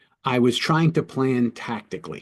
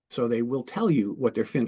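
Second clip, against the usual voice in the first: ordinary talk, nothing marked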